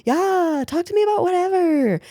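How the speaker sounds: high, syrupy fake-friendly voice; mock sweet false friend; fake sympathy. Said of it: "who are just like".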